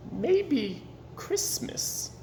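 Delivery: wondering thought. Acted. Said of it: "he thought".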